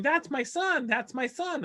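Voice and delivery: imitating an excited mom, high-pitched; proud insistence. Mom keeps telling everyone it's her son on TV, excited.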